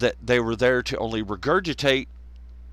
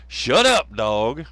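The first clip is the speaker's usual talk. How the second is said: yelled scolding; 6 dB louder, slower than usual. Real, not acted. Podcaster to his noisy dog, yelled scolding. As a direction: yell it to cut the dog off.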